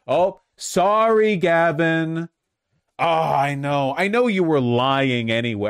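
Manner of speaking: loud, high, drawn-out fake sympathy; mocking, exaggerated condolence; mock apology. Mock apology to absent politician.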